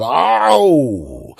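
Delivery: loud, slow groan of a stiff back; pained groan. Retold or imitated. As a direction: ache as you stand up. Morning back pain groan.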